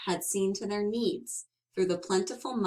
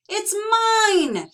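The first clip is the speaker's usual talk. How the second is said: much higher and louder, a squeaky yell; shouted; 13 semitones higher, 8 dB louder than usual. Acted. Sorrel shouting at Bruce over the pine cone. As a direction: grab the prize with a shout. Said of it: "shouted Sorrel".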